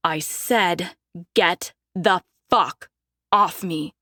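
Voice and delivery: slow, held-down rage; low furious warning. Acted. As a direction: keep it low but seething. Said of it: "keep my voice low".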